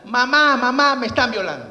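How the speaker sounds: put-on high, loud child's cry for help; child screaming. A child shouting for his mother through the door, loud and high. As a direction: yell it like a frightened small boy. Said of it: "un niño de seis años que grita".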